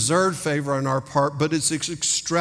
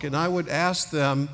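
ordinary talk, nothing marked

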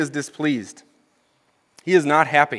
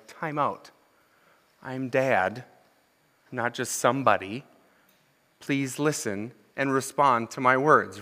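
a hint of a stern father, softer and slow; stern dad; 4 semitones lower, 7 dB quieter, slower than usual. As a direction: quietly scold an ignoring child, x0.7. Parent voiced scolding child.

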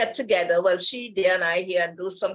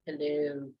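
deep sexy hello; 6 semitones lower, 8 dB quieter than usual. Retold or imitated. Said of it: "very deep, sexy voice".

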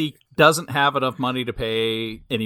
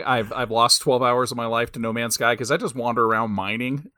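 ordinary talk, nothing marked

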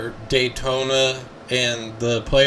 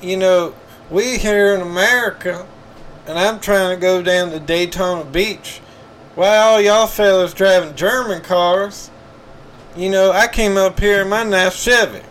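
hammed-up Southern accent; 7 semitones higher, 4 dB louder than usual. NASCAR fan lost at a golf tournament, loud high Southern accent. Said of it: "I got one more thing on that".

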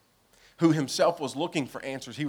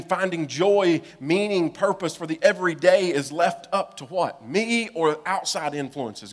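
normal talking voice

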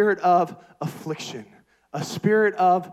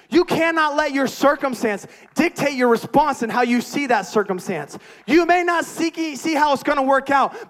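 loud, higher, driving preacher voice; full-force preaching; 4 semitones higher, 5 dB louder than usual. Acted. Preacher stirring the congregation to respond, preaching at full force. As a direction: preach it hard to the back row.

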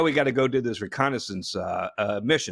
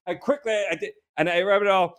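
ordinary talk, nothing marked

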